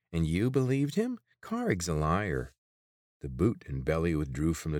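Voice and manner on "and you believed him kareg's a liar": nervous nasal guard. A narrator's reading.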